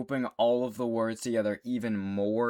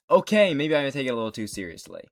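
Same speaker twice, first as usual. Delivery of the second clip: ordinary delivery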